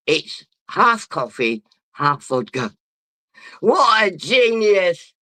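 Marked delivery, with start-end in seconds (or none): 3.6-5.1 slow, loud self-praise; boastful shout. Real, not acted. Drunk vlogger praises his own drink, slow loud shout. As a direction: crow it proudly, drawn out. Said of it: "I'm just so fucking good".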